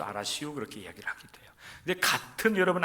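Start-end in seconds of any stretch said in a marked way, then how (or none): 0.0-0.5 low, soft 알았슈 in Chungcheong dialect as a promise. Shown as a demonstration. Preacher demonstrates Chungcheong 알았슈 as a promise, low and soft. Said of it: "의미로서". 0.5-1.5 soft unvoiced whisper. Real, not acted. Preacher drops to a whisper to the congregation.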